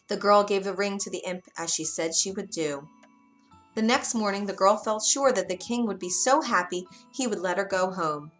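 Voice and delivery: usual speaking voice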